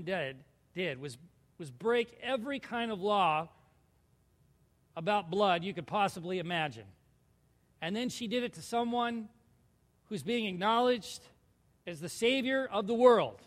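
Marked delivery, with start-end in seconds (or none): none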